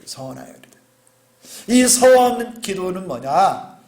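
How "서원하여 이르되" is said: soft murmured repeat of 서원하여 이르되; 서원하여 이르되 repeated very softly; quiet echo. Shown as a demonstration. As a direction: drop to a near-whisper on 서원하여 이르되.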